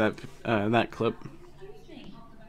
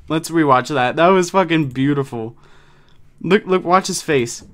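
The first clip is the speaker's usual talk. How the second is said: ordinary delivery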